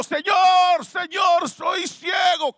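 desperate shout. Acted. Blind Bartimeo shouts for Jesus, desperate yell. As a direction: scream for the Lord's attention.

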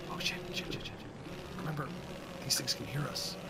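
urgent hushed whisper. Real, not acted. Player warning team the game can hear them, whispering. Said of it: "Why are we whispering?".

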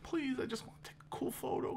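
hushed, desperate mock plea; pleading whisper. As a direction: whisper it like begging the sky. Acts a pleading photographer.

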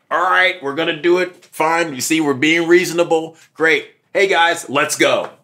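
rally the others to leave; calling out. Retold or imitated. Telling the officer fine and calling the crowd back, loud. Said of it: "I'm like".

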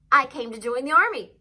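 put-on loud, high eager boy; bold declaration. A narrator's reading. Boy declares his purpose to brother.

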